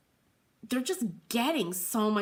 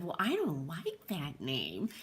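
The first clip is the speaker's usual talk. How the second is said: put-on dead serious kid; 4 semitones lower, 7 dB quieter than usual. The girl looking the host in the eye, in lowered earnest seriousness. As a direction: lower and softer, flat and earnest. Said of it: "in all seriousness said".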